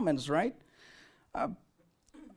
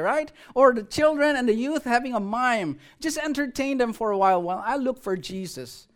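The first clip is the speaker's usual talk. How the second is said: ordinary delivery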